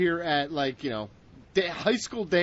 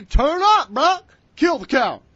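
loud, high hype shout; loud hype yell; 5 semitones higher, 8 dB louder than usual. Acted. Reading comment loudly.

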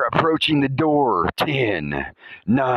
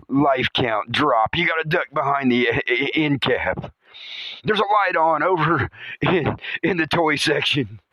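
normal talking voice

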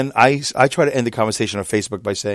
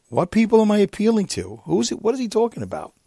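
much higher, baffled and incredulous; baffled inner voice; 11 semitones higher than usual. Retold or imitated. Recalling the friend's post, thinking it in high-pitched disbelief. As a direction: ask yourself in disbelief. His own baffled thoughts.

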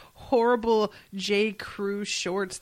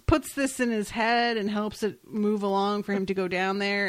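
ordinary talk, nothing marked

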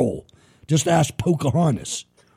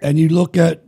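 normal talking voice

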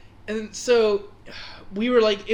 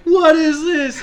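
louder and higher shocked question; 9 semitones higher, 9 dB louder, slower than usual. Retold or imitated. Teller recalling his swollen leg, loud shocked question. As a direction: sound stunned at the swelling. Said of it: "I was just like".

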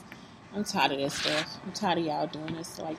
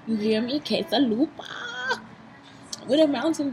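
excited hype; 6 semitones higher than usual. Pretend. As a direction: stretch the name out with over-the-top relish. Hyping the snack emphatically.

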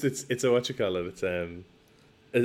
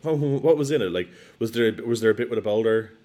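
quizzing dad; 3 semitones higher, 7 dB louder than usual. Retold or imitated. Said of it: "And he's like".

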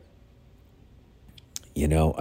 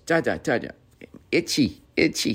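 lightly voiced little kid, higher; complaining toddler; 5 semitones higher than usual. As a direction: imitate a toddler's small complaint.

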